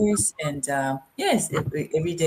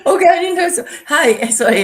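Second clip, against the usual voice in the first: embarrassed laugh; 12 semitones higher, 10 dB louder, faster than usual. Real, not acted. Caught off guard, laughing embarrassed.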